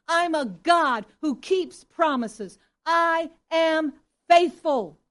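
loud, slow proclamation of God's words; preaching at full force. Acted. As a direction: declare each line slowly and loudly, like a herald.

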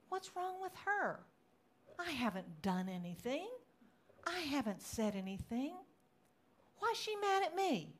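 acted-out indignation at being wronged; indignant inner complaint. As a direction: retell a baffled, wounded grumble. Retelling her indignant thoughts.

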